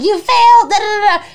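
high loud mock sob; berating herself, crying loudly; self-berating wail. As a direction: scold yourself, hammed-up. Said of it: "I'm like".

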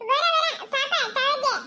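high, stretched-out sobbing pleas; wailing character voice. Acted. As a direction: cry out no, no through tears. Same crying character voice.

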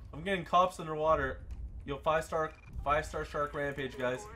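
usual speaking voice

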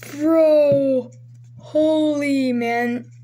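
real thrill at the pull; stunned excitement. Real, not acted. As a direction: let it out in disbelief. Reacting to prized card.

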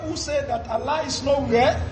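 ordinary delivery

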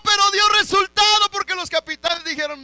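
very high, loud shout; preaching shout. Real, not acted. Preacher repeating the remark about the king's cry, preaching shout. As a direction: belt it out to the whole church.

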